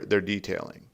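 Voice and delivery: ordinary delivery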